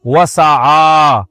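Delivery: long drawn-out Arabic recitation; Quran recitation. Acted. Preacher recites the Quran's second condition to listeners, slow recitation. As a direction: stretch the vowels as in tajweed.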